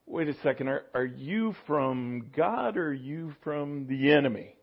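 hushed, unsure inner voice; quietly second-guessing the voice; a soft, doubtful mutter to himself; doubting Peter. Pretend. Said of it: "it wasn't a matter of".

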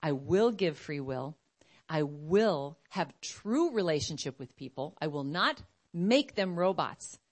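grave, unhurried divine reply; voice of God. Acted. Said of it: "And he's like".

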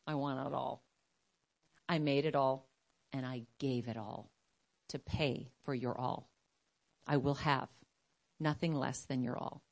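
low, hushed and deliberate; solemn divine demand with long pauses; voice of God. Acted. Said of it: "the Lord is like".

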